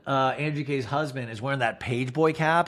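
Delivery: usual speaking voice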